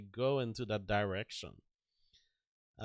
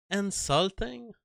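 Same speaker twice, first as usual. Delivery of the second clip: incredulous; 8 semitones higher than usual. Real, not acted. Incredulous echo of the ruling.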